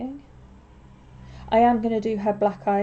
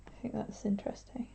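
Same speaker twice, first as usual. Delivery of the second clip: whispering; 10 dB quieter than usual. Real, not acted. Quiet aside while painting.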